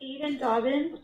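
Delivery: ordinary delivery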